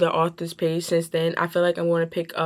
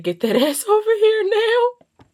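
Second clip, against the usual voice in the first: laughing quote; 7 dB louder than usual. Acted. Quoting a steamy line through laughter.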